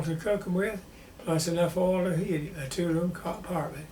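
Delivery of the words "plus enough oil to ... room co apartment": ordinary delivery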